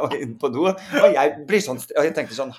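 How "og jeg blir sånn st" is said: ordinary talk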